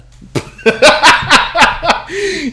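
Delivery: loud burst of laughter; big laugh. Real, not acted. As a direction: crack up laughing. Laughs at own name mistake.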